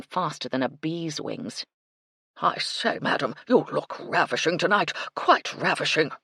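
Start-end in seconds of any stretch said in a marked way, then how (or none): 2.4-6.1 colonel gushing compliments; blustery old colonel. A narrator's reading. Colonel flattering a lady he meets, effusive bluster. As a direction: play an effusive old military gentleman.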